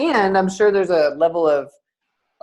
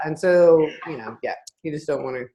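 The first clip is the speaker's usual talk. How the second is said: ordinary talk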